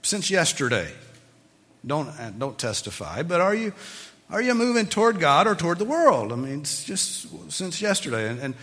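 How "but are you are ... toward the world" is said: louder and higher, pressing the question home; rising preacher's challenge. Real, not acted. Preacher challenging his congregation, raised and insistent. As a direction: push the question at them hard.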